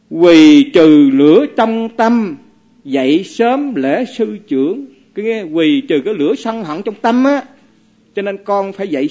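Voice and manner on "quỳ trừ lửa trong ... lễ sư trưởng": slow, louder chanted verse; reciting verse. Acted. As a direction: intone the two lines solemnly. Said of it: "nói một bài kệ như thế này". (đọc kệ chậm, trang nghiêm)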